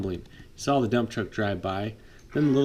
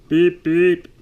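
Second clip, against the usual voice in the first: loud high beep beep like a horn; 6 semitones higher, 10 dB louder than usual. Acted. Little snowplow blowing his horn, loud honking beeps. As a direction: honk it out like a toy horn.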